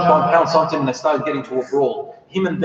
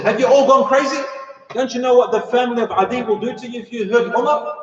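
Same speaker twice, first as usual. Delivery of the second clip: high-pitched indignant rebuke; acted outrage, voice pitched well up; indignant scolding; 8 semitones higher than usual. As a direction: scold a whole crowd for their folly. Clansman scolds the retold crowd.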